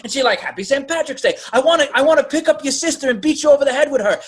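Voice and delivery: high, fast mock caller voice; mock prank caller.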